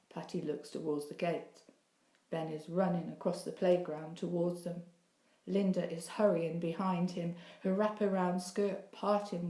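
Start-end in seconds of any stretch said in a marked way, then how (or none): none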